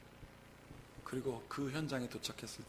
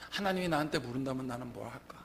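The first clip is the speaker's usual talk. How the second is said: ordinary delivery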